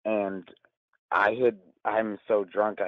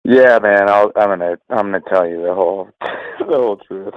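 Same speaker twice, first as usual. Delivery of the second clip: loud; 13 dB louder than usual. Real, not acted. Caller shouts his oath.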